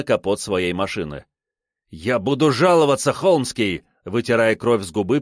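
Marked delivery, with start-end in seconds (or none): 1.9-3.8 raised threatening voice. A narrator's reading. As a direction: shout a threat, bloodied. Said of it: "пригрозил он".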